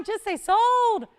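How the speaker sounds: loud, high urging cry; excited sold call. Real, not acted. Host urging callers to phone in, loud and excited. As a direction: whip buyers into a frenzy.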